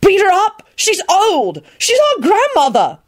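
high, exasperated protest; heated incredulous retort; furious. A narrator's reading. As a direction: argue back in outrage. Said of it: "he said furiously".

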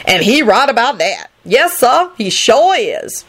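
swooping, self-satisfied character voice; pleased boaster. A narrator's reading. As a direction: agree with proud relish, stretching the end. Same happy character keeps boasting.

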